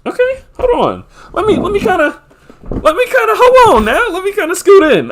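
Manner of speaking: very high squeaky put-on voice; silly falsetto. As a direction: play it in a goofy, sky-high falsetto. Goofy aside while adjusting the view.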